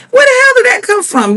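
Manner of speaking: high-pitched, exasperated disbelief; incredulous outburst. Real, not acted. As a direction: react in baffled exasperation. Baffled reaction to contestant's question.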